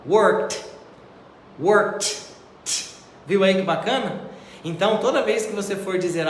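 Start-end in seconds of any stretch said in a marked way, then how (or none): 0.0-0.6 Worked said with a final T, no D sound; teacher modelling Worked with a T ending; model pronunciation. Shown as a demonstration. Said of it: "a pronúncia do verbo work no passado". 1.6-2.2 Worked repeated with a final T; model pronunciation. Shown as a demonstration. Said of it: "a pronúncia do verbo work no passado".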